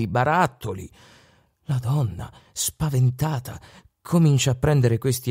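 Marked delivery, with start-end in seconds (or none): none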